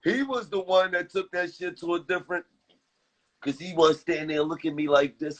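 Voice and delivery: normal talking voice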